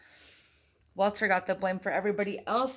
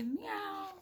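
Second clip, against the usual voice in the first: kitty meow; 13 semitones higher than usual. Pretend. Kitty sound for a child.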